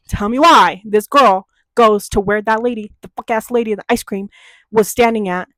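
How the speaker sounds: ordinary talk, nothing marked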